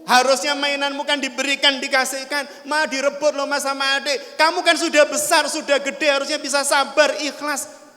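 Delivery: high, insistent mock telling-off; scolding father. Father scolds four-year-old over toy, high-pitched acted scolding. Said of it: "dimarahi oleh ayahnya".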